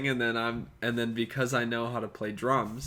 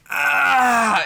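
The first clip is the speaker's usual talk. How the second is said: much higher, loud effortful groan; 12 semitones higher, 9 dB louder than usual. Retold or imitated. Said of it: "in the loudest way possible".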